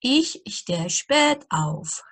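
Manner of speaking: each word laid out slowly for learners; slow dictation German. Acted. Teacher reading an example sentence to beginners, slowly. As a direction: read it out slowly and clearly for beginners.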